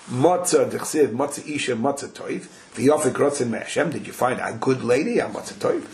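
normal talking voice

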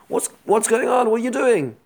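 high-pitched, retold alarm; alarmed confrontation. Micha runs out to confront the men taking his idols, alarmed.

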